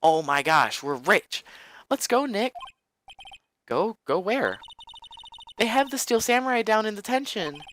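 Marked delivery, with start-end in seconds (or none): 1.9-7.6 much higher, excited push; high eager girl. Acted. Maya urges Nick to take the case, high voice. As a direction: urge him on in a high girl voice.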